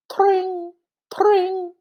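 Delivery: high, bright tring, tring; bicycle bell. Shown as a demonstration. Reading to a child, ringing high like a bicycle bell. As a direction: ring it out like a bike bell. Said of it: "A bicycle bell ringing".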